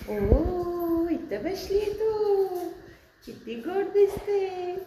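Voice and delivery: high, slow cooing. Real, not acted. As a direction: coo to a kitten. Cooing to a cat. (लाडिक बोबडा आवाज)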